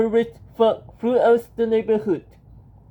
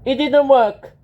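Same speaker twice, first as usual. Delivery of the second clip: alarmed cry; 4 semitones higher, 6 dB louder than usual. A narrator's reading. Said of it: "cried old Howard".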